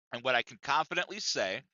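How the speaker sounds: normal talking voice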